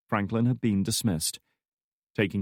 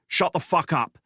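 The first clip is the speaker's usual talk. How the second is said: higher, louder angry snarl; 8 semitones higher, 5 dB louder than usual. Acted. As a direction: snap at her furiously. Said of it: "he snarled".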